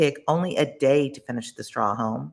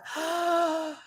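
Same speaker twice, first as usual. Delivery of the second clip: sharp high intake of breath; big inhaled gasp. A narrator's reading. The wolf breathes in to blow the house, a big gasp. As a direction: suck in air to blow.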